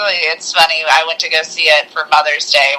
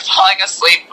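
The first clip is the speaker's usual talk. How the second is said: usual speaking voice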